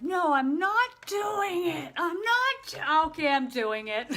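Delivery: loud, high, laughing mock protest; hammed-up whiny refusal that caves into a laugh. Medium acting out arguing with spirits, loud and laughing. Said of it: "and I'm like".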